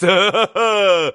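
loud excited laugh; burst of laughter. A narrator's reading. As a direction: laugh out, eager. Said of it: "крикнул Митя".

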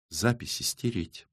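soft, matter-of-fact demand; quiet order. A narrator's reading. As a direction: give the order softly and evenly. First quiet order, repeated angrily after.

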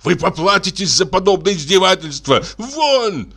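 high furious orders; angry shout. Acted. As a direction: drive him out angrily. Passengers throw him out. (гневный окрик)

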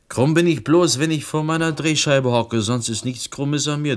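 indignant outburst. Acted. Answering her jibe about his crooked kin, indignant and loud. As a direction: bristle at the insult.

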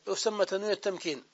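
ordinary talk

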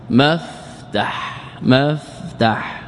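مفتح very slowly split, maf...tah, a in both syllables; two fathas. Shown as a demonstration. Teacher deriving the noun for students, very slow syllable demo. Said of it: "زدنا ميما مفتوحة وفتحنا العين".

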